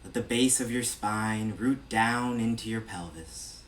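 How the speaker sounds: measured meditation-guide voice with long pauses between phrases; evenly projected, spacious meditation delivery; guided meditation. Real, not acted. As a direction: lead a body scan, unhurried and steady. Leads a body scan meditation.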